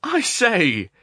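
loud, high-pitched objection; nervous, snarling protest. A narrator's reading. Dragged along by the arm, he protests with nervous irritation. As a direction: protest sharply, uneasy underneath. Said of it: "laughing nervously but with an undertone of snarl".

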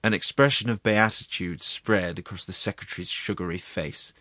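plain reading voice

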